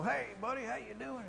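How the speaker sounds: high, breezy hey-buddy greeting; a pushy, chummy missionary hello; brash American greeting. Retold or imitated. Missionary greeting a local too familiarly, high and breezy. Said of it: "I can't walk up to you and go".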